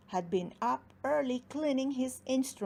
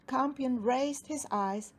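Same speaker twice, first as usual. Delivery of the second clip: plain reading voice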